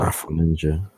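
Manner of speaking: AFRO NINJA said with no R, as Af-on-ninja; Afro without R. Shown as a demonstration. Offering a co-host the name, AFRO NINJA without the R. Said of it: "there's not an R".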